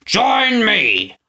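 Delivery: boastful proclamation. A narrator's reading. Snatcher boasts to the crowd, grandiose. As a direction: crow it to the crowd. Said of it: "Snatcher boasted".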